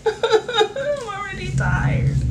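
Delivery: high, slow, laughing I'm already tired. Real, not acted.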